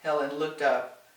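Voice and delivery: plain reading voice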